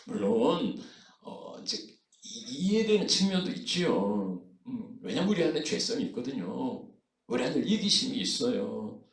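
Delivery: usual speaking voice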